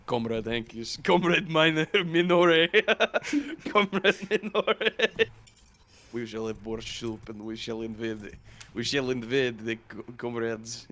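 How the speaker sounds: hammed-up Russian comrade accent; thick Slavic accent, breaking into laughs. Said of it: "My Slavic accent was better than yours".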